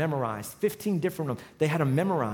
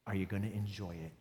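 quiet voice of God; 9 semitones lower, 13 dB quieter than usual. Retold or imitated. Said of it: "He said".

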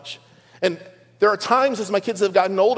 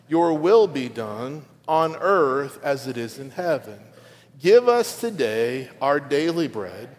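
slow, measured congregational prayer reading; group recitation; 3 semitones lower, slower than usual. Real, not acted. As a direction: lead the room through it phrase by phrase, slowly. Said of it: "we read together out loud".

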